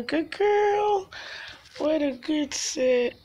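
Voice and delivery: delighted praise for the cat; pet praise. Real, not acted. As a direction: squeal the praise up high.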